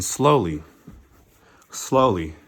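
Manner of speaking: low, measured narration with pauses; slow, deliberate. A narrator's reading. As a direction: weigh out each "slowly". Narrator acts out the slow walk.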